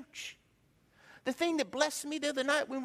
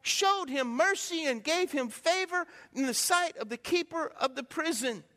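slow, measured Bible quote; scripture reading; 3 semitones higher, slower than usual. Real, not acted. Pastor reading Genesis to his congregation, slow and solemn. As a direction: read the verse with weight. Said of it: "The Bible says in Genesis".

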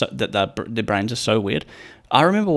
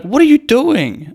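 high, loud, slow shocked question; shocked mum; 13 semitones higher, 6 dB louder, slower than usual. Retold or imitated. Mum bursting in on her son's naked nap, shocked and loud. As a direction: mum shocked to find him napping naked.

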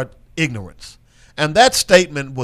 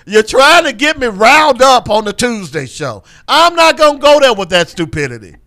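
high, loud exasperated protest; riled up; 9 semitones higher, 6 dB louder than usual. Real, not acted. Host refusing co-host's bait on the show, riled up. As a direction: refuse loudly, seeing through the wind-up. Accuses co-host of riling him up.

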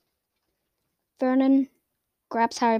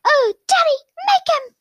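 crying tantrum, way up high; whining brat. Acted. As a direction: beg Daddy like a spoiled child. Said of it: "It's like".

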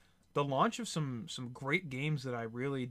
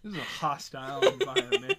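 laughing mock complaint; 7 semitones higher, 7 dB louder than usual. Real, not acted. Laughing at partner's joke.

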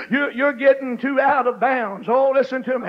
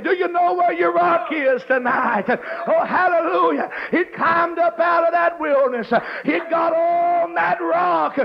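rising preaching shout, slowed on rock; 4 semitones higher than usual. Acted. Preacher calling the congregation to climb onto the rock, rising shout. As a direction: build the sermon to a full shout.

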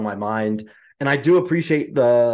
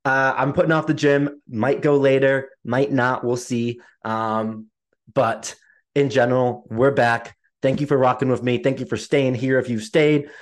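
usual speaking voice

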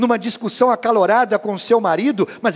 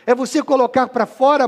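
usual speaking voice

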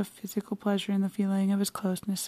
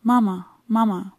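louder joyful calling out; rapturous shout; 7 dB louder than usual. A narrator's reading. Son running down the stairs shouting for his mother, rapturous. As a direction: call out to mother with delight. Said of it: "with desperate rapture shouted".

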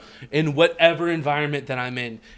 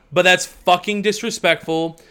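ordinary talk

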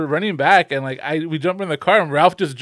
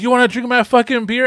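mock-angry challenge, pitched up; retold angry demand; 7 semitones higher than usual. Angry man confronts partygoers over his beer, retold with mock anger. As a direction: imitate a pissed-off guy, voice raised in pitch. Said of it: "the guy gets fucking pissed".

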